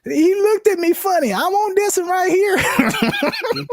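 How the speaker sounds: put-on very high, slow squeaky girl voice with a laugh; hammed-up little girl impression; Honey Boo Boo. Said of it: "She's like".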